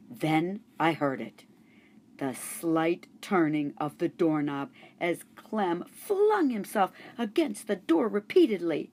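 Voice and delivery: ordinary delivery, nothing marked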